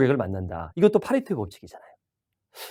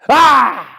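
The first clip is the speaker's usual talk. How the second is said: sharp loud high 아; mock yelp of pain; 8 semitones higher, 9 dB louder than usual. 귀 찌른 척하는 짧은 비명. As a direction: yelp like the pick jabbed the ear.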